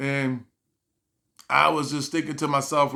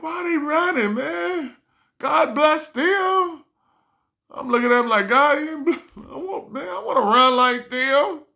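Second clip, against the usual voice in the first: higher, puzzled voice; bemused wonder; 10 semitones higher than usual. Retold or imitated. Watching churchgoers run and scream, high and bemused.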